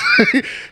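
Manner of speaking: loud, high burst of laughter. Real, not acted. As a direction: crack up at your own impression. Laughing at own impression.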